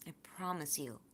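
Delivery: whispered, barely voiced promise; soft promise. Real, not acted. Vlogger promises grieving viewers, in a whisper. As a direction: breathe the promise out to them gently.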